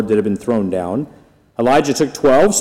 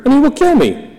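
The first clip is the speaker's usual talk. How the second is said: a servant cries out his terror, higher; frightened cry; 12 semitones higher than usual. A narrator's reading. Servant begging Elijah, afraid Ahab will kill him, high frightened cry. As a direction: voice leaps much higher on the fear of death. Obadiah's fearful plea to Elijah.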